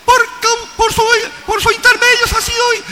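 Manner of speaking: very high, ringing metallic words. Shown as a demonstration. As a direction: speak like clanging metal. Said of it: "palabras metálicas".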